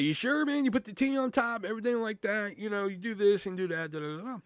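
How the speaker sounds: high-pitched patter tailing off; persuasive sales patter. Retold or imitated. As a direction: coax a wavering shopper, then let it fade. Re-enacting persuasion of hesitant customer.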